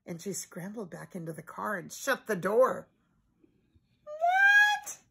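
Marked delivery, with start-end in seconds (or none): 4.0-5.0 very high squeaky puzzled dog voice; cartoonish bewildered pup. A narrator's reading. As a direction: ask in baffled dog surprise. Lassie confused.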